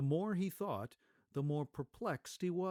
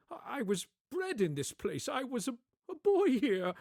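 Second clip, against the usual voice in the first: higher, louder, stammering with emotion; moved old man; 11 semitones higher, 7 dB louder than usual. Acted. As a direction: choke up at the memory.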